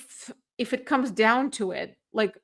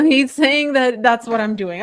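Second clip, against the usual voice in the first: ordinary talk, nothing marked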